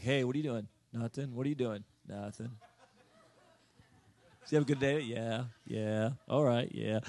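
low, dopey imitation of a teen on the phone; awkward phone small talk. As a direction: mumble low, like a tongue-tied teenager.

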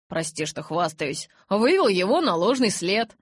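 loud, high, boastful; cocky reassurance. A narrator's reading. Jacob boasting to Bella about tricking Charlie. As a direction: brag that you've fixed it.

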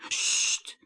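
soft hushing whisper; urgent whispered shush. A narrator's reading. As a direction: hush him before the sun hears. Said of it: "fluisterde Avondster".

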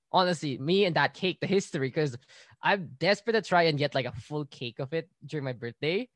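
ordinary talk

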